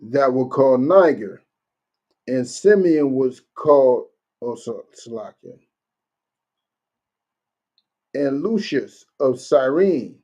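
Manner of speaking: slow, deliberate Bible reading; scripture reading. Acted. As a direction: read the names out slowly, verse style. Resumes reading the verse.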